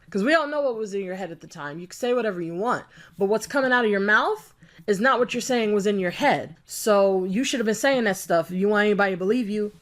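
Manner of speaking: ordinary talk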